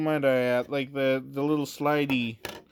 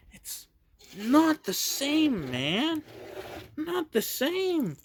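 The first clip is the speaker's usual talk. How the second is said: mock lament; 11 semitones higher, slower than usual. Lamenting the newer set.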